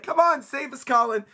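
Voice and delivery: high, loud mock panic; panicked plea. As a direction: beg to be rescued in a hammed-up squeal. Voices panicked kids begging for rescue.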